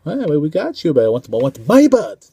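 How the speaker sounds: put-on Mickey Rourke Russian accent. Reviewer imitating the villain's demand, loud mock Russian accent. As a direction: ham up the Russian villain demanding his bird. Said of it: "poor Russian accent from Mickey Rourke".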